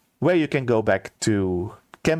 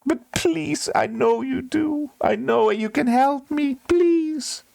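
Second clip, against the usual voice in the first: begging steward; slower than usual. Acted.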